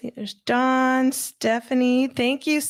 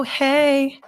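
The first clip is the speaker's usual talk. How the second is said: usual speaking voice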